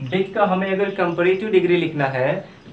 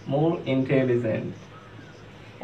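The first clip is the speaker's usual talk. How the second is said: more intelligent repeated much softer and even slower; 4 semitones lower, 7 dB quieter, much slower than usual. Shown as a demonstration. Teacher repeating the comparative softly for students. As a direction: echo it quietly for the class to follow. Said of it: "comparative form में लिखना है तो क्या हो जाएगा".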